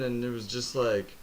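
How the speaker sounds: ordinary talk, nothing marked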